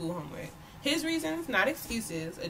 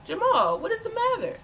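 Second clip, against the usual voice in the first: concerned mother; 12 semitones higher, 6 dB louder than usual. A narrator's reading. Mother questions upset son.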